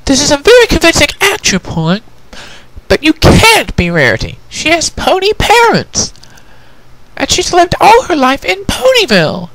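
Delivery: put-on high, firm, insistent young mare; Twilight, arguing. Twilight argues with the prisoner, firm and high.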